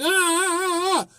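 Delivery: high pained "ah" cries in mock imitation; imitated pained cries. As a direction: mimic her yelping as the bandage comes off. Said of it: "she was sitting there like".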